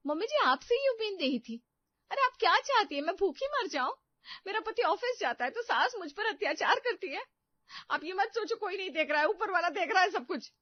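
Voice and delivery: high, loud wailing complaint; melodramatic outburst. Acted. Daughter-in-law shrieks at mother-in-law over breakfast, melodramatic outburst.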